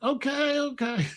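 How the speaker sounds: small high sheepish voice; meek compliance. Retold or imitated. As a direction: imitate youngsters giving in to a scolding. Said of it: "They'd be like".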